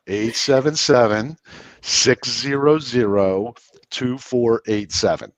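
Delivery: phone number at slow Southern speed; deliberately slow, spaced-out digits; slowed right down. Shown as a demonstration. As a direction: draw out each digit, pausing between groups. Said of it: "take it from seventy-eight down to forty-five".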